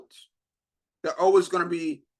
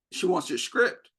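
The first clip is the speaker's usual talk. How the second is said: usual speaking voice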